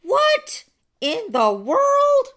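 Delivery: high, loud, slow squeal of disbelief; shrill stretched what in the world; shocked disbelief. Retold or imitated. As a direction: stretch it out, floored. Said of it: "I was like".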